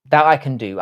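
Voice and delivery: ordinary delivery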